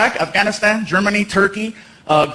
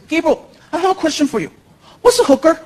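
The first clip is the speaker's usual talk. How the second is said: put-on little boy; 8 semitones higher than usual. Son asks stepdad in kitchen, little boy voice. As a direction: play a curious child.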